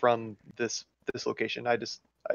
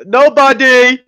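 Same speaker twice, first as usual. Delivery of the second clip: loud fake answer; 10 semitones higher, 15 dB louder, much slower than usual. Answering the voice behind the door.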